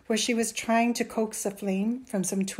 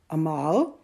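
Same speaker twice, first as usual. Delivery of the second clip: loud, warning call of his name; reproving; 3 semitones lower, 7 dB louder than usual. A narrator's reading. Mother doubts her son's claim, reproving. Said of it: "his mother's voice was reproving".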